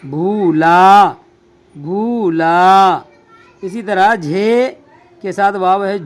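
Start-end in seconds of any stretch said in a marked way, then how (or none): 0.1-3.0 slow, drawn out. Shown as a demonstration. Teacher reading भूला to students, slowly drawn out. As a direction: read भूला slowly, each sound held long. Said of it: "क्या पढ़ेंगे?".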